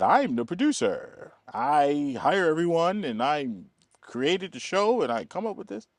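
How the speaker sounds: a hint of the silver-haired European, proud and unhurried; eccentric producer. Producer explains his role to the extra, a lightly imitated high voice. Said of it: "That's how he talks".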